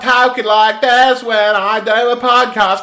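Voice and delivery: put-on loud joking Cockney accent; mock Cockney. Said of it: "it is Cockney".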